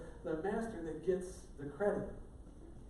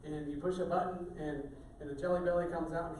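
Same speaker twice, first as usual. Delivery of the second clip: usual speaking voice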